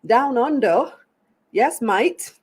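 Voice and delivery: playful attempt at an Aussie accent; mock Australian accent. Host greeting a viewer in Australia, in a mock Australian accent. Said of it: "Terrible accent".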